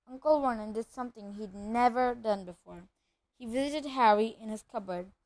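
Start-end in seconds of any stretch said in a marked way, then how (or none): none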